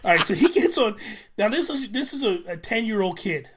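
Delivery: hyped storyteller leaning in; excited buildup. Real, not acted. Telling friends about the cousin's prank call, getting excited. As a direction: pitch climbing as the story gets going.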